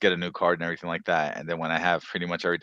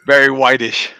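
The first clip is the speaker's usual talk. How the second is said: laughing; 6 semitones higher, 13 dB louder than usual. Real, not acted. Joking pun on a name.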